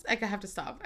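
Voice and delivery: usual speaking voice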